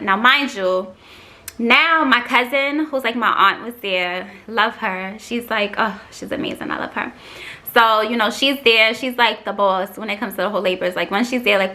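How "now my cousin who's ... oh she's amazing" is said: ordinary delivery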